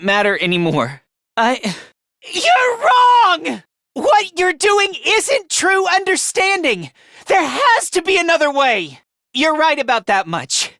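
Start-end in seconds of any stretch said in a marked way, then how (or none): 2.3-9.0 high, impassioned rebuttal; defiant outburst. Acted. Fighter insisting there is another way, defiant.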